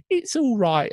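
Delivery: very high put-on girl voice; squeaky caricature of a British girl; Nancy. Nancy reassuring the children about the Doctor, squeaky put-on voice. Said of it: "she talks like this".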